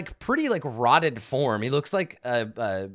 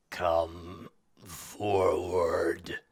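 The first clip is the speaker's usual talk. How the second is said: very slow, low, rasping whisper; sickly ruler's whisper; 5 semitones lower, 4 dB quieter, much slower than usual. Acted. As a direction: draw each word out in a sinister whisper. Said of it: "and he says".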